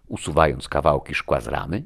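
ordinary delivery

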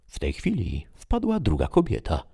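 plain reading voice

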